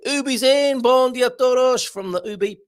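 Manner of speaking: light up as if a favourite regular just walked in; excited greeting. Acted. Host welcoming regular listener Ubi, excited high greeting.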